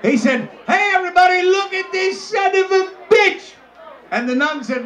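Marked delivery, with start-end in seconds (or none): 0.7-3.5 high, raised-voice announcement; excited holler. Retold or imitated. Gardener showing everybody his huge fish, excited hollering. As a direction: holler it to the whole room, pitched high.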